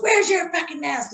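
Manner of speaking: mock angry yell. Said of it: "starts yelling at me".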